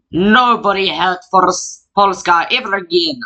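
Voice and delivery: lightly put-on Polish accent; bad Polish accent. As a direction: try a Polish accent, not convincingly. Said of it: "how to do a Polish accent".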